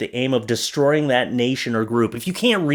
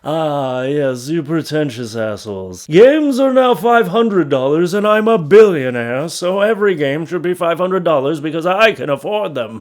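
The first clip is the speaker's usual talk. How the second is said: louder, pompous rich-tycoon voice; Monopoly man gamer; 3 semitones higher, 5 dB louder than usual. Retold or imitated. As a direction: boom it out like a smug billionaire. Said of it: "the Monopoly man just became a gamer".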